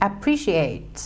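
appreciate run together quickly. Shown as a demonstration. Teacher shows appreciate in quick speech, syllables run together. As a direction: say appreciate as one quick, connected word. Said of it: "เวลาพูด พูดเร็วๆ จะได้เป็น".

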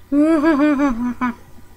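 long, bubbling closed-mouth giggle; goofy giggle. Acted. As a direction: giggle on and on playfully. Goofy laugh showing off hat.

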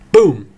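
loud mock boom; explosion sound effect. A narrator's reading. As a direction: shout a boom. Narrator imitates explosion.

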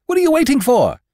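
high, loud impatient cry. A narrator's reading. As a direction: demand it of him. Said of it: "they cried".

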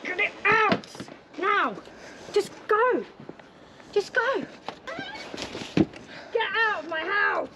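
screaming. Real, not acted. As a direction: scream at them to get out. Screaming agents off property.

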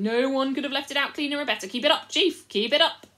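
loud fawning chorus; eager loud cheering. Acted. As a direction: cheer the chief on loudly.

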